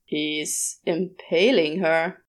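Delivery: low, slow dry demon; deadpan observation, drawn out; Crawley's voice. A narrator's reading. Crawley flatly describes what Adam is doing.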